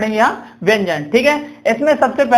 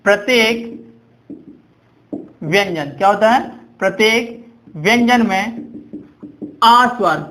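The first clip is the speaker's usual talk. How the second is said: dictation pace. Shown as a demonstration. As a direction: pause between phrases so they can write. Dictating definition for students to write. (धीरे, रुक-रुककर लिखवाते हुए)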